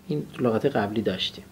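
ordinary talk

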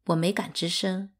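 plain reading voice

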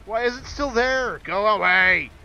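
high, loud, slow scared shout; frightened yell. Real, not acted. Yelling at the monster to leave.

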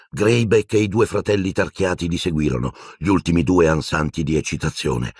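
ordinary delivery, nothing marked

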